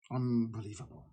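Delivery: quiet, disbelieving murmur; muttered disbelief. Real, not acted. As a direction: breathe it out in disgust. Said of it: "repeated word, soft then full".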